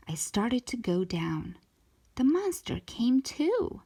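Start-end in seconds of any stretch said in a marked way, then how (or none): none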